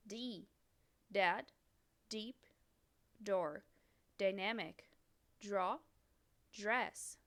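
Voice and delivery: each English word said clearly with pauses; teacher reading a vocabulary list in English; English model words. Shown as a demonstration. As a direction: model the English pronunciation for repeating. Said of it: "o B tem o mesmo som".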